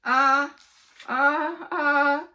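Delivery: high, loud groan. Acted. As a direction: moan it in frustration. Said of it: "groaned Ryan".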